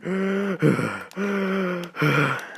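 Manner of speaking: sharp high gasp for breath; imitating a desperate gasp for air; panicked gasp. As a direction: gasp like you can't get any air. Panic attack on mountain, can't breathe.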